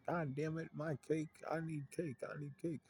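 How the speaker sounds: high-pitched imitation of a cake craving; hammed-up high whine for cake; mock cake tantrum. Voicing Big Mom's craving for cake.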